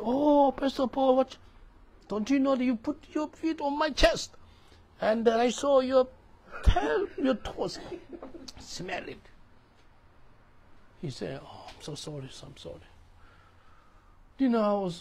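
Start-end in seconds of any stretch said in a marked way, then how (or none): 0.0-9.2 high, loud, outraged protest with a laugh; indignant complaint. Retold or imitated. Homeless man protesting to the pastor, high and loud. 11.4-12.9 soft, breathy half-whispered sorry; sheepish apology. Retold or imitated. Pastor apologizing to the roommate, soft and sheepish.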